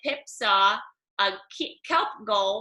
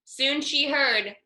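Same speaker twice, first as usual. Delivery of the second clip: plain reading voice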